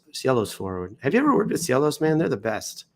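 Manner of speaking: ordinary talk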